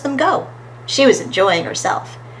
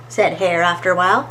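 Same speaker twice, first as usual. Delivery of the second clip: ordinary delivery, nothing marked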